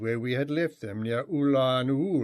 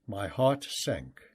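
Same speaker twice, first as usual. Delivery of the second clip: plain reading voice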